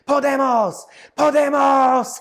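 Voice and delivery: high, loud, slow, drawn-out shout; panicked student shouting from the shower. Retold or imitated. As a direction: yell the one Spanish word you know. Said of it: "He just starts shouting".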